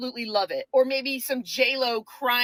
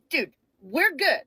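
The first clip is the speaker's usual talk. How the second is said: normal talking voice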